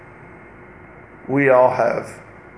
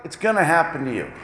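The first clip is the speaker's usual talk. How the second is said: ordinary talk, nothing marked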